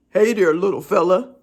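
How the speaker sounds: lightly voiced delivery guy greeting the dog; man's voice. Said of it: "And then I hear".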